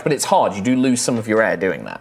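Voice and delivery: ordinary talk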